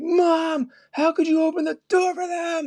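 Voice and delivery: high mock scream at mom; hammed-up screaming son. Man screams at mom who let cops in, mock scream. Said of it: "he was screaming".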